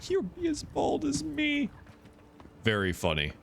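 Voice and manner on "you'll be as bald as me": mocking imitation. Mocks character's line.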